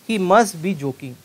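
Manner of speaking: very slow, loud example read; slow dictation. Shown as a demonstration. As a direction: spell the sentence out slowly. Teacher reads example sentence.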